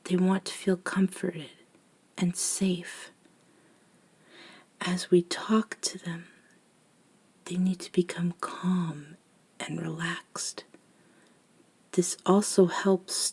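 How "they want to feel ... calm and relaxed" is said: hushed, half-whispered reassurance; soft and soothing. Shown as a demonstration. Vampire explaining how humans are calmed before feeding, soft and soothing. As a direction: lull the listener, trailing into a whisper. Continues showing the calming soft talk.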